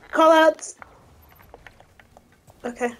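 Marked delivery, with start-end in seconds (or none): 0.1-0.8 loud demanding call, pitched up; shouting. Real, not acted. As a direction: shout it across the team. Demanding callouts from team.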